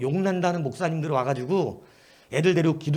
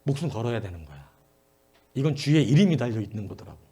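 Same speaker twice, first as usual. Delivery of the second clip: ordinary talk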